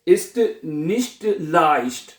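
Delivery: each word stretched very slowly; very slow dictation. Shown as a demonstration. Teacher dictating ist nicht leicht very slowly. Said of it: "क्या बनेगा?".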